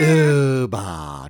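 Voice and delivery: drawn-out, falling "ooo-bah" noise; imitated pleasant sound. Joking about a sound pleasant to children, imitated noise. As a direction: stretch it out like a silly sound effect. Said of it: "The most pleasant sound to a child".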